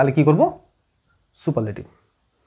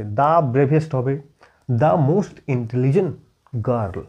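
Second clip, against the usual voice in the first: slow dictation; 4 semitones lower, much slower than usual. Shown as a demonstration. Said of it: "বুঝতে পারলে?".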